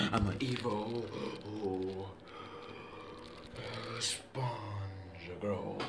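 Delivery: very soft, low pretend-villain whisper; evil whisper. Child playing an evil Sponge Girl, sinister whisper.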